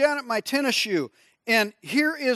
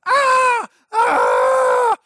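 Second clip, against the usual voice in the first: high pained yelling; retold scream of pain; 8 dB louder than usual. Fireman screaming against the gym wall in pain. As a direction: reenact screaming from a dislocated toe. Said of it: "I'm just screaming".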